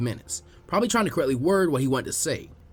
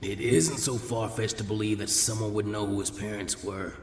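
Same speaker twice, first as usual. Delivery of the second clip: put-on lower, calm old man's voice; gentle, low old village elder; old Hokage; 8 semitones lower, 7 dB quieter than usual. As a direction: speak like a patient elderly leader. Hiruzen inner thought, lower.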